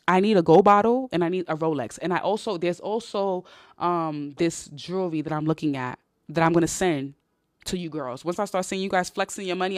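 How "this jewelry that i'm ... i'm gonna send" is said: ordinary talk, nothing marked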